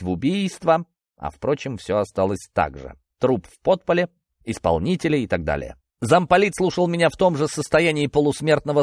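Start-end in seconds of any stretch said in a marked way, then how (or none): none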